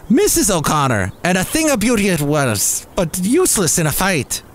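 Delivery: high-pitched Irish pub voice; joke-telling Irish voice; attempted Irish accent. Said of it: "I'm gonna try and do an Irish one".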